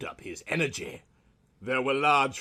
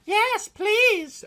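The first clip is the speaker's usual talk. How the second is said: put-on very high, squeaky girlish voice; Kimmy, giggly; 7 dB louder than usual. A narrator's reading. Roommate Kimmy accepts tea, very high girlish chirp. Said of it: "announced Kimmy, giggling".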